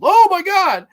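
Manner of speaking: hammed-up fright, high and loud; mock shriek. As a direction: squeal it like a startled viewer. Mock fright at jump scare.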